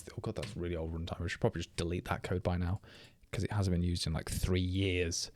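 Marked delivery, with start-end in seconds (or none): none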